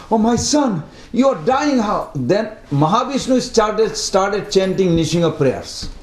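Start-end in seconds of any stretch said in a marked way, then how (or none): 0.1-2.1 mild alarmed father. Retold or imitated. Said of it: "was so scared".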